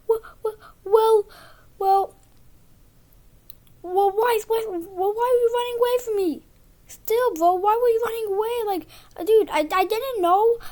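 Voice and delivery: high, fast, mock whiny kid voice. Boy mimics classmate Jack questioning him, high and whiny.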